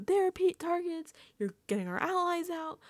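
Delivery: high-pitched imitation of a scolding; mock angry yelling. Imitating Keanu yelling at Vince, mock angry. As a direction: rattle off accusations high, mimicking him. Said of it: "He's, like, yelling at Vince".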